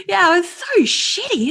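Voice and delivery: high teary laugh; laughing through tears. Real, not acted. Laughing after crying.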